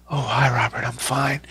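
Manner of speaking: a soft, mellow hello from a man who plays villains; gentle, mellow Michael Wincott. Retold or imitated. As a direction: hush it down, gentle and unhurried. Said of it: "so gentle and so mellow".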